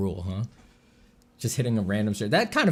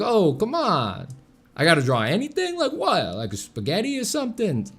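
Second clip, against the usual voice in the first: Italian New Yorker; 9 semitones higher, 4 dB louder than usual. Retold or imitated. Imagined New York Italian stranger balking at drawing, hammed-up accent. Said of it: "an Italian guy".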